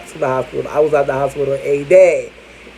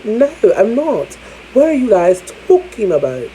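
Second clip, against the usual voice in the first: higher-pitched, hammed-up English accent; 6 semitones higher than usual. Denying being from the U K, in mock English accent. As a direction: protest in an exaggerated English accent. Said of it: "I'm speaking like I'm a bloody freaking Englishman".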